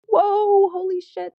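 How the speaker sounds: sudden high, loud whoa, then a gasped curse; startled cry. Retold or imitated. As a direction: jolt of fright at the swerve. Near hit, swerving onto sidewalk.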